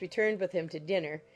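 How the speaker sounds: ordinary delivery, nothing marked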